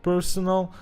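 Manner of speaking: ordinary delivery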